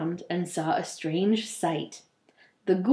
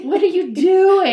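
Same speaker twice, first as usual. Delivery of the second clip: higher, louder incredulous cry; exasperated yell; 9 semitones higher, 8 dB louder than usual. Real, not acted. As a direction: yell at him in disbelief. Scolding the hero.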